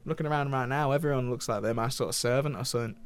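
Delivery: host playing a cheeky northern English voice actor; lightly put-on northern English accent, chatty and blunt; hammy northern English character. Host plays Nolan from North.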